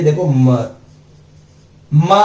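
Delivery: usual speaking voice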